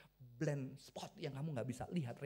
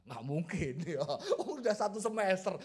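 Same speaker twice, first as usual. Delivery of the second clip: retold scoff, breaking into laughter; incredulous chuckle on the words; laughing disbelief; 6 dB louder than usual. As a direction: dismiss it through a laugh. Scoffs at his inner voice.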